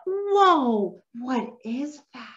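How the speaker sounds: gasp, then ask in awe; exaggerated wonder. Shown as a demonstration. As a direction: act amazed for a child. Modelling wonder before a pause.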